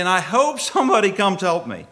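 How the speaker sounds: loud, high retold plea; desperate plea. Retells desperate thought while drowning.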